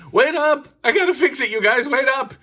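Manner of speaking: put-on high, loud kid yelling after friends; mock kid yelling to friends to wait; kid calling out. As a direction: yell for the others to stop.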